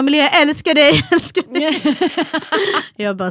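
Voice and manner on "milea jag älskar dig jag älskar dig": loud, high declaration with laughter; calling out affection. Retold or imitated. Dad calls to Milea on FaceTime, loud and high. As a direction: call it eagerly down a video call, laughing.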